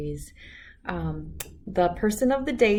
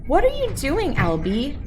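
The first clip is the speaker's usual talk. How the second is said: put-on higher, sing-song kid voice; teasing cousin; 9 semitones higher, 6 dB louder than usual. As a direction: poke fun at the odd kid. Cousin teases Albert.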